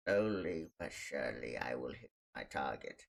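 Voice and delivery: low, soft villain voice; sinister and deep, through an evil grin; evil schemer. Retold or imitated. Brother taking aim at the door, evil scheming. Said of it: "he does an evil smile".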